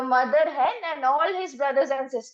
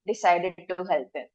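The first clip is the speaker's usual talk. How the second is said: ordinary delivery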